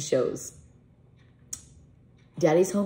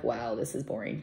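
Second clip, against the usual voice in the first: low, soft mock-bored mumble; bored viewer; 7 semitones lower, 8 dB quieter than usual. Host imitates viewers waiting through her rambling, mock bored. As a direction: imitate bored viewers under your breath. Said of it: "You guys are like".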